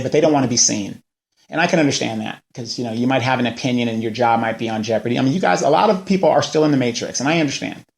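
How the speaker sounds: ordinary delivery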